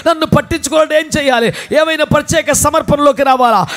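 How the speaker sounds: loud, high fervent sermon; full-force preaching. Acted. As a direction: drive it home to the congregation. (ఉద్రేకపూరిత ప్రసంగం)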